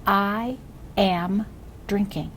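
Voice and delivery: slow, word-by-word read-aloud; each word drawn out and separated; model reading. Shown as a demonstration. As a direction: read it very slowly for a beginner to echo. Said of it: "Watch me again".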